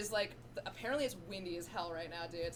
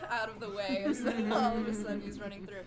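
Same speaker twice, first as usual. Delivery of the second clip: normal talking voice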